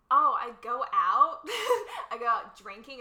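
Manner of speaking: put-on loud, laughing Valley girl. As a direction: squeal it out like a party girl. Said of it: "Is this a Cali accent?".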